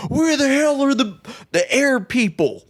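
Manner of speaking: high, strained yell of an army general. Retold or imitated. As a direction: bark it like a panicking general. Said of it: "The general's like".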